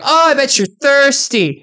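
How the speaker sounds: sweetly mock the tired riders; mocking taunt. Offering water to thirsty cyclists in a high mocking voice. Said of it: "just to mock people".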